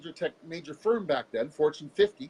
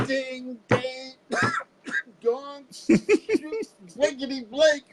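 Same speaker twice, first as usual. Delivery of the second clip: sound effects; 10 semitones higher, 5 dB louder, much slower than usual. Retold or imitated. Banging head on tile, sound effects.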